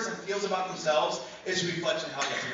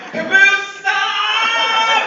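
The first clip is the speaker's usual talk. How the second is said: high-pitched loud scream; child's full scream; 13 semitones higher, 12 dB louder, much slower than usual. Retold or imitated. Second grader alarming the museum, full-volume scream. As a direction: shriek it as loud as you can. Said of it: "she screams as loud as she can".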